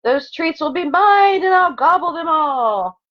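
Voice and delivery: ordinary talk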